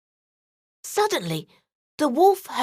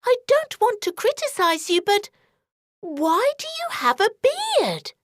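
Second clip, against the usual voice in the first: put-on higher, questioning girlish voice; girl eyeing the bed, higher; suspicious girl; 10 semitones higher than usual. A narrator's reading. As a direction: ask it doubtfully like a wary child.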